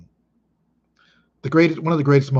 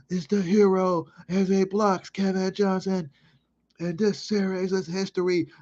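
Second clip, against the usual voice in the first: Neil Funk radio call; 8 semitones higher than usual. Retold or imitated. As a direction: relive the winning call like the broadcaster. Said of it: "I can remember Neil Funk on the Bulls radio station".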